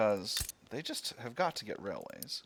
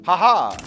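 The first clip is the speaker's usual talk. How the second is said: gleeful laugh; 7 semitones higher, 16 dB louder than usual. Real, not acted. Gleeful win in game.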